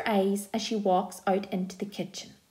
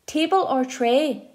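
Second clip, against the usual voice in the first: shouting; 5 semitones higher, 4 dB louder, slower than usual. A narrator's reading. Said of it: "shouts Mum".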